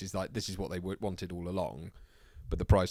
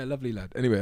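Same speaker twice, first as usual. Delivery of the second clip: ordinary delivery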